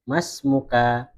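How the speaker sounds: slow "Mas muka" with a full final vowel; bacaan lengkap. Shown as a demonstration. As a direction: sound every syllable of "Mas muka", -ka included. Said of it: "Ini kalau kita membaca". ("Mas muka" dibaca pelan, akhirannya berharakat)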